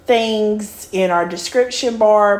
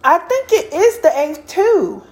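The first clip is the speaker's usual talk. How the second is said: trying not to laugh; 9 semitones higher than usual. Real, not acted. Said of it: "trying not to laugh".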